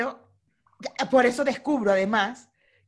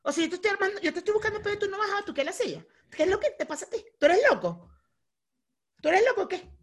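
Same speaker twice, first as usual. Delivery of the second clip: high-pitched, incredulous mock challenger; provoking opponent; 8 semitones higher, faster than usual. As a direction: imitate someone egging on a fight. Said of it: "La otra persona te va a decir".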